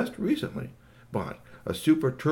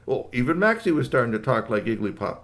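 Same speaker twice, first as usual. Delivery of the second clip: ordinary delivery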